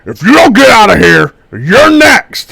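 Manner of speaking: high, very loud half-orc roar; shouted threat. Acted. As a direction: threaten them at full volume. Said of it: "he looks up and he says".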